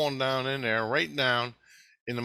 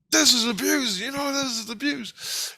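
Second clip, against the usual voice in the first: high-pitched mock scolding; put-on high protesting voice; indignant rebuke; 13 semitones higher than usual. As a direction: voice someone calling out abuse, pitched way up. Acts out Christ confronting her.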